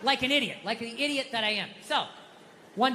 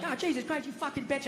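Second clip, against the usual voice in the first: higher, softer mock outburst; mock cursing; 3 semitones higher, 7 dB quieter than usual. Husband pretends to curse out his wife, mock cursing. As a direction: toss off a mock curse, voice lifted and quiet.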